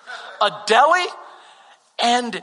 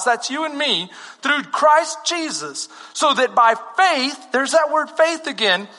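impassioned scripture preaching, climbing higher; building preaching; 6 semitones higher than usual. Real, not acted. Preacher telling the congregation they are Abraham's children, building fervour. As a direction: build the verse toward a high, ringing declaration.

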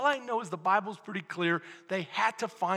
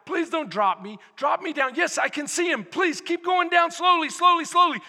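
anxious plea, rising higher and louder; paralyzed man pleading; 7 semitones higher, 6 dB louder than usual. Acted. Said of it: "So you're the guy".

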